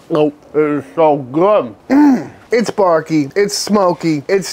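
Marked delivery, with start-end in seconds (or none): 1.9-2.3 high, louder mm of pleasure; a delighted mmm at the taste; savoring mm. Real, not acted. Tasting the beef rib.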